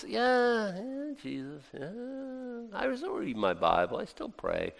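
a hint of a weary, half-hearted churchgoer, trailing off soft; mock-weary shrug of a voice; bored lukewarm believer. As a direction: lightly mimic someone going through the motions.

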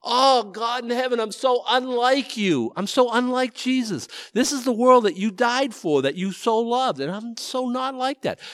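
high, pleading cry to God; anguished confession. Retold or imitated. Curmudgeon driver owning up to God, retold anguished prayer. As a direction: confess in dismay, pitch held high. Acting out his remorseful prayer while driving.

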